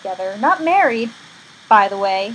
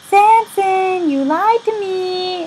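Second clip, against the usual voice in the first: high, drawn-out whine of reproach; hammed-up wounded complaint; mock hurt accusation; 8 semitones higher, 4 dB louder, much slower than usual. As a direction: accuse your lover in a high put-on voice. Delilah confronts Samson, acted.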